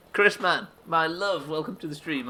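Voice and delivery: loud, high welcome settling down; big high hello, softening into chat; effusive greeting. Real, not acted. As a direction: call out fondly to an arriving viewer. Restarts the interrupted greeting.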